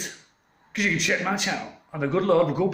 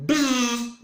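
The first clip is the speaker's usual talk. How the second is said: buzzing siren imitation; 10 semitones higher, 4 dB louder than usual. Imitating police siren noise.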